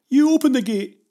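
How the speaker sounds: loud, high-pitched snappy command; snapped order. A narrator's reading. Jenny refusing Pavel's request to open the gate, snapping. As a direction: throw the order back at full volume. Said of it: "she snapped".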